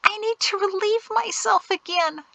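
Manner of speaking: high, whiny whimper; small, high, pleading whine. A narrator's reading. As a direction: whimper it in desperate discomfort. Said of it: "Jatesy whimpered".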